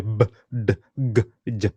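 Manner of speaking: B, D, G, J as short emphatic consonant sounds; B, D, G, J each voiced hard, separately. Shown as a demonstration. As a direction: sound out B, D, G, J with a bit of force. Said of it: "they have a bit of emphasis on them".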